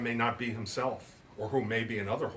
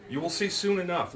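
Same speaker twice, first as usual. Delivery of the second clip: mild promise; 6 semitones higher, 3 dB louder than usual. Acted. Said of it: "Lizzie promised".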